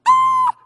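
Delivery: very high piercing shout; sudden high yell; sharp shout. Retold or imitated. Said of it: "Jesus goes".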